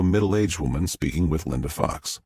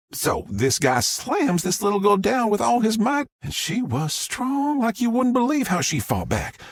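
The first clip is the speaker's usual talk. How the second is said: excited retelling. Acted. Witness excitedly describes the fight.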